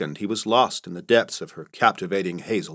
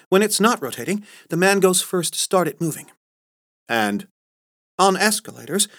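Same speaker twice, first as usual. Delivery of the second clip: higher prim etiquette-teacher voice; a proper lady spelling out the rules, pitched up; Carla lecturing lightly; 8 semitones higher than usual. Acted.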